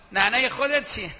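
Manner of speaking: loud, jeering insult; mock insult. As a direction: throw it back as a mock insult. Acts rude insulting retort.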